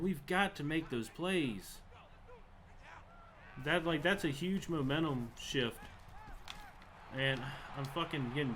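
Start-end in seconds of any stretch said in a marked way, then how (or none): none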